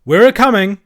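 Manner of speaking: high, very loud rustic reply; calling out. A narrator's reading. Andrew answering the boys in the tower, calling out. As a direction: holler up to them.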